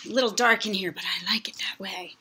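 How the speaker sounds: breathy whisper to the camera; whispered aside, half playful. Acted. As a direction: hush it like a secret.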